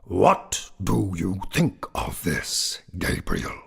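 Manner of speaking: slow, drawn-out goblin voice; taunting goblin. A narrator's reading. Goblin shows off to the sexton, slowly taunting. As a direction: draw the question out as the goblin.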